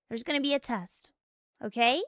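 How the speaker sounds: ordinary talk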